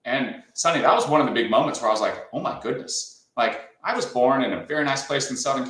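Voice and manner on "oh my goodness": breathy near-whispered oh my goodness; stunned. Retold or imitated. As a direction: let the realisation knock the voice out. Retold moment of shock.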